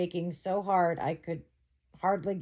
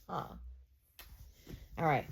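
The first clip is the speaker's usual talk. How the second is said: ordinary delivery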